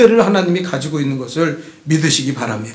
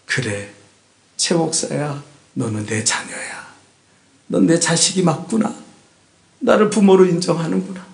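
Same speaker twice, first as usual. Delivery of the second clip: tender God voice; 4 semitones lower than usual. Retold or imitated. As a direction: speak as a loving father to his child. God speaking tenderly to pastor. (최 목사에게 말하는 하나님, 낮고 다정하게)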